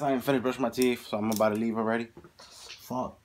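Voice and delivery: ordinary talk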